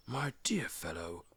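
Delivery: a wizard leaning in, hushed; Gandalf whispering. Acted. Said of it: "said he".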